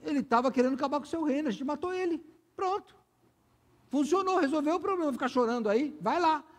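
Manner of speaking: high, pushy political advisor; blunt Joab. Retold or imitated. Joab urging King David to quit weeping, high and pushy. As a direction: push the king to stop crying. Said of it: "Falei assim".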